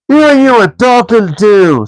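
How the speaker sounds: high, loud troll challenge; troll William bristling at Bert; angry troll. A narrator's reading. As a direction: square up to him. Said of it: "said William".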